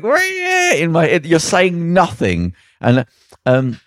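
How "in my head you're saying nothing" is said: exasperated. Retold or imitated. His own exasperated inner thought.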